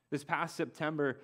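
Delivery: ordinary delivery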